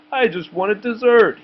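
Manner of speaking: put-on high, whiny kid voice; a boy pleading innocence to the principal; innocent boy excuse. A narrator's reading.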